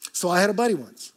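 ordinary talk

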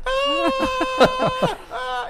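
high shrieking "Ah, ah" of a falling cartoon sun; imitated screaming. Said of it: "he's just screaming".

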